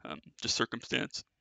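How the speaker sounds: ordinary talk, nothing marked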